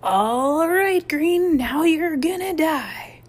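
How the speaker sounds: high, loud, drawn-out threat; piercing battle yell, stretching the last words; half-crazed scream. A narrator's reading. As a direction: shriek it like a crazed boy out for revenge. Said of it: "the half-crazed voice of Adam Willis".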